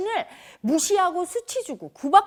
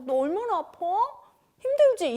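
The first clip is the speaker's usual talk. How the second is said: fussing sympathy; 7 semitones higher than usual. Retold or imitated. People fussing over sick one.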